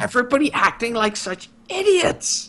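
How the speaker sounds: high, exasperated mock scream; retold frantic yelling. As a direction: wail it high without real volume. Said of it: "basically screaming in his ear".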